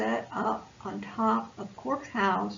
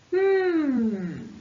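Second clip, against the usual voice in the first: thoughtful hum; 4 semitones higher, 5 dB louder than usual. Acted. Buzz thinking.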